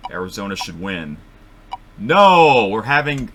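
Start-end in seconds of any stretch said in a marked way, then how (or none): 2.0-3.3 high, very loud cry of disbelief; loud outburst. Real, not acted. Host reacts to the wheel picking an upset, loud outburst. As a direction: burst out loudly at the shock result. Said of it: "sorry if that was loud".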